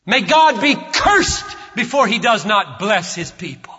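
slow, loud, ringing preaching; thundering sermon climax; full-force preaching. Acted. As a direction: proclaim each line slowly, building to a shout. Preaching climax over music.

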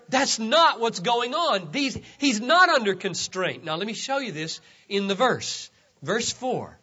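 usual speaking voice

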